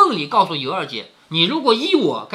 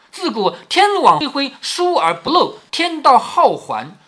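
higher, slow recitation of a proverb; solemn slow recitation, a little higher; reading aloud; 6 semitones higher, 4 dB louder than usual. Acted. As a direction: lift and slow each phrase like a saying. Reads San-jie's warning aloud.

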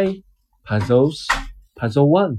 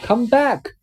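a small mock call after her; lightly called out; 9 semitones higher than usual. A narrator's reading. Spider calling after Miss Muffet, lightly called out. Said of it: "called the spider".

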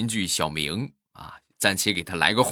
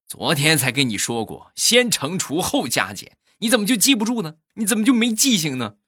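imitated nagging that climbs high and louder; scolding wife; 9 semitones higher, 4 dB louder than usual. Mother scolding child over homework, rising high-pitched reprimand. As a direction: build to an exasperated telling-off. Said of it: "当时就训斥".

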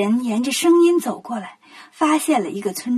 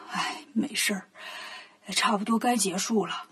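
put-on soft, lower old man's reply; resigned reassurance; 4 semitones lower, 11 dB quieter than usual. Old man tells guests it's fine, soft and low. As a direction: wave it away quietly.

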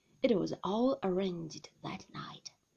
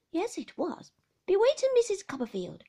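a higher, louder, firm insistence; stoutly insisting; 10 semitones higher, 7 dB louder than usual. A narrator's reading. Boy insisting to his mother she was bewitching, stoutly. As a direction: plant your feet and insist, child's stubbornness. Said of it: "I repeated stoutly".

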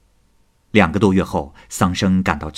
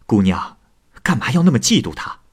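chuckle, then higher teasing question; laughing; 5 semitones higher than usual. A narrator's reading. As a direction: laugh it off playfully. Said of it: "笑着说". (笑着打趣地说)

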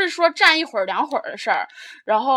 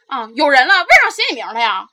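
mock indignant retort; 7 dB louder than usual. Seat stealer retorts on the bus.

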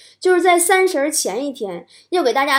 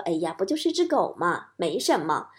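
dismissive mom; 6 semitones lower, 6 dB quieter than usual. Retold or imitated. Mother waves away thanks.

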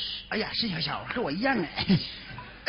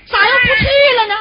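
hammed-up wailing question, higher and louder; mock crying; 9 semitones higher, 11 dB louder, faster than usual. Brother-in-law pleads with sister-in-law, mock crying. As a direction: fake sob at sister-in-law's refusal. He learns she won't come.

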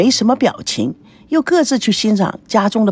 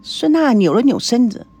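ordinary delivery, nothing marked

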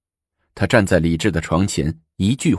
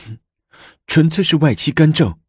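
higher-pitched indignant huff; huff, then accuse in a raised pitch; 9 semitones higher, 3 dB louder than usual. A narrator's reading. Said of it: "愤愤地说". (愤愤地哼一声说)

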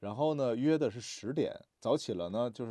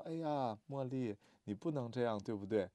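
gentle scolding; 8 dB quieter than usual. Retold or imitated. Father gently scolding child.

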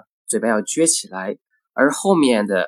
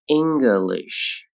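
"English" slowly, ending in a long sh; slow careful "English"; drawn-out model. Shown as a demonstration. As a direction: stretch "English" out for learners. Teacher models word slowly, then normally.

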